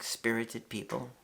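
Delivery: ordinary delivery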